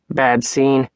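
lightly voiced gruff man. A narrator's reading. Rock finally breaks the tense pause, mildly gruff. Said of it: "his gruff voice filling the tense pause".

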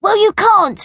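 high, loud schoolboy squabble; acted childish contradiction, pitched high; bossy retort. A narrator's reading. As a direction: shut the other child down. Children quarrel over playing pirates.

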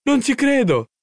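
high-pitched cry of amazement; exploding cry. A narrator's reading. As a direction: burst out in excited disbelief. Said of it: "esplose in un grido".

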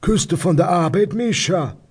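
high-pitched Rhineland dialect neighbour; nosy neighbour. Acted. Neighbour passes on news from the street, high dialect voice. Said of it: "von meinem Nachbarn, Herrn Fassbender".

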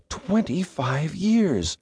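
slow, wondering echo of the number; awed echo. Acted. Girl marvels at how long he has known Doug, slow and awed. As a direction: marvel at the long years, drawing it out.

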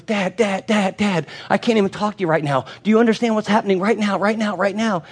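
high, fast, urgent pleading; a boy too worked up to listen; frantic kid. Retold or imitated. Son begging his dad to hear about the game, high and frantic.

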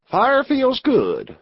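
high, slow cowboy voice; burly outlaw. A narrator's reading. Burly outlaw by the new fire, slow and high.

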